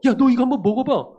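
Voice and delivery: calling out, thrilled by the taste; loud excited mock customer; excited call to a friend. Customer calls friend to try.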